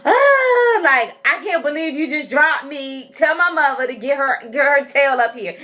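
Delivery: high wail into sassy complaint; irritated baby. Retold or imitated. Baby voiced after being dropped, mock irritation. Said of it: "Symphony was just more irritated than anything".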